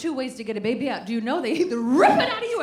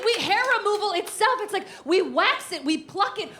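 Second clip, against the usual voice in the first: ordinary talk, nothing marked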